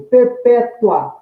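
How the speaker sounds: "perpétua" said slowly, stress on PÉ, "tua" as one glide; teacher slowly saying "perpétua" with the "ua" as one syllable; slow demo word. Shown as a demonstration. Teacher to students, slowly showing "perpétua" with the "ua" as one syllable. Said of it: "o A eu falei também num único impulso".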